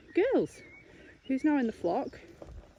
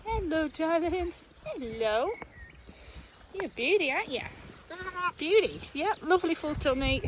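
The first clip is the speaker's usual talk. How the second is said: higher, fond cooing to the lambs; 6 semitones higher than usual. Real, not acted. Farmer fussing over Lila's lambs, higher fond coo. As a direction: coo at them like babies.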